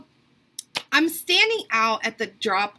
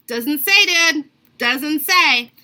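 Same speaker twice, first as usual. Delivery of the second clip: loud, emphatic comeback, pitched up; retold indignant retort; 3 semitones higher, 7 dB louder, much slower than usual. Customer proving the rude clerk wrong, indignant. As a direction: push each word at him, loud and insistent.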